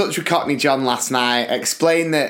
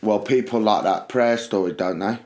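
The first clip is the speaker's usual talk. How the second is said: lower, softer, deadpan suggestion; mock deadpan mate; 6 semitones lower, 3 dB quieter than usual. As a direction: offer it dryly, low and casual. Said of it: "he went".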